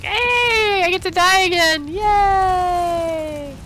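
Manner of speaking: high, loud sarcastic cheer about dying; mock glee. Gamer mocking her eagerness to die in PvP, hammed-up glee. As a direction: cheer far too brightly about dying. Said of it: "I'm like".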